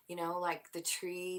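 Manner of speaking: normal talking voice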